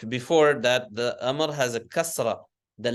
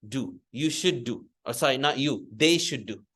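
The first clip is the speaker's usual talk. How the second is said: ordinary delivery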